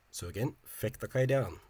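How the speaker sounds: normal talking voice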